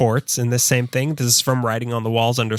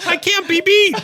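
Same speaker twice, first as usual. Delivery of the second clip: very high squeaky salesman, laughing through it; giggling pitchman voice; high-pitched ad voice; 14 semitones higher than usual. Retold or imitated. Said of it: "a high-pitched nasally voice".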